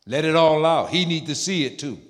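normal talking voice

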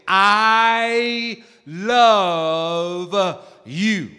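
extremely slow, every word stretched out; emphatic. Shown as a demonstration. Preacher correcting a casual version, emphatic and drawn out. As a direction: hold "I" and "love" very long. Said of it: "say it".

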